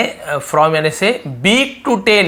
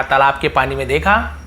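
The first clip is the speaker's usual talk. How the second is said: usual speaking voice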